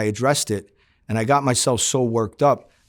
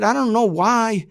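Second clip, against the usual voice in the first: exasperated outburst; 11 semitones higher, 3 dB louder than usual. Retold or imitated. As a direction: throw it at Louis, fed up and pleading. Confronting Louis about war.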